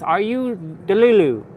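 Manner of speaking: high, loud imitation Jamaican accent; Jamaican or African accent. TikTok guy questioning girls, imitated Jamaican accent. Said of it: "he'd be talking like this".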